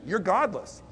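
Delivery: usual speaking voice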